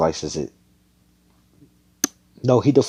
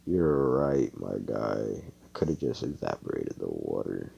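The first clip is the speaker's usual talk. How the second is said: much lower, softer admission; deflated concession; 10 semitones lower, 8 dB quieter than usual. Acted. Magna admits he could have evaporated it, deflated. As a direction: sink down low as you concede. Character sheepishly concedes his mistake.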